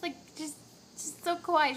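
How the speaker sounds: ordinary delivery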